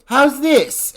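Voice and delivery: high, coy voice, the actress showing off for the director; flirty Trish. Retold or imitated. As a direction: show off coyly, pitched high. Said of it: "she's like".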